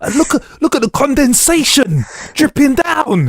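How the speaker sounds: high, mock-pretentious voice; arty photographer. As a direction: gush over condensation like a poser. Mocking arty photo-taking.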